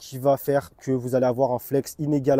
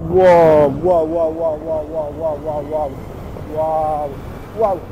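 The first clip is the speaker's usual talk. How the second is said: excited wows; much slower than usual. Real, not acted. Thrilled while accelerating fast.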